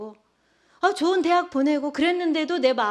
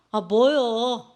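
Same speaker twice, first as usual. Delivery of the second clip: slow, drawn-out and louder, grudging; sullen teen retort; 6 dB louder, much slower than usual. Retold or imitated. Teen son sulkily answers his dad's question. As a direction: drag it out like a sulky teenager. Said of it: "이렇게 하는 거예요".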